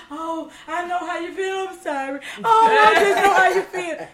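loud, high mock wail through laughter; imitating crying mama. Mother comforting her pregnant daughter, mock wailing loudly. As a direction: ham up mama crying along. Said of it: "She crying too".

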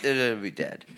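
ordinary talk

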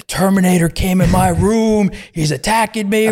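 put-on a drugged kid babbling to the doctor; high mock panic, imitated; panicked kid. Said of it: "He goes".

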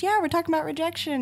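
hammed-up breeziness; mock chirpy. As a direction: toss off bad news cheerfully. Mock-chirpy podcast invitation.

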